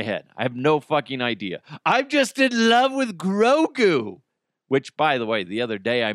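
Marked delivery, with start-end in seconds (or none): 1.9-4.2 gushing. Real, not acted. Gushing over Grogu.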